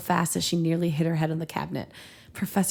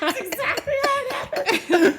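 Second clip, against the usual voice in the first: much higher, laughing; 3 dB louder than usual. Real, not acted. Cohost cracking up at the joke, laughing. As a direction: giggle the words out. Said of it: "laughing reply".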